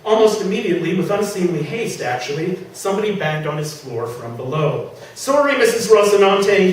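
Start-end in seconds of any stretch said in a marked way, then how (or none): 5.1-6.7 louder, higher call down to the neighbour; shouting apology. Acted. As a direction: call the sorry down through the floor. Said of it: "he shouted".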